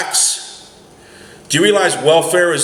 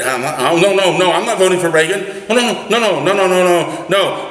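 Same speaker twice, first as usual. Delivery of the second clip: mock fearful voter; 6 semitones higher than usual. Caller mocks voters afraid to admit Reagan, hammed-up nervous. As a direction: play a jittery voter protesting too much, pitched up. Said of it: "People back then were even almost afraid".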